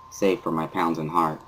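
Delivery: ordinary delivery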